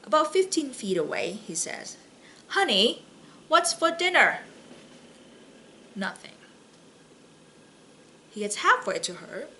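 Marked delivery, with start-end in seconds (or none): 2.5-4.4 raised, higher call across the kitchen. Acted. Husband calls to his wife from across the kitchen, raised call. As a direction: call out to a wife fifteen feet away.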